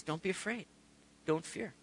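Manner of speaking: soft, gentle "don't be afraid"; soft reassurance. Retold or imitated. Jesus calming frightened disciples, soft and gentle. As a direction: comfort a frightened friend quietly.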